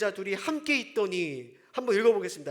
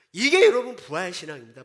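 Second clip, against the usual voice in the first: louder, punched declaration; emphatic; 3 semitones lower, 8 dB louder than usual. Real, not acted. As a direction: drive home the key phrase. Preacher stresses key phrase. (힘주어 강하게 외치듯)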